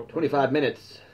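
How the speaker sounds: startled at the time, pitching "Twenty-five minutes" up; surprised. Real, not acted.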